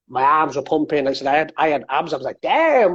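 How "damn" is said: a higher, louder, impressed damn; a pleased exclamation of self-admiration; impressed with himself. Retold or imitated. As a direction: hype yourself up at the mirror.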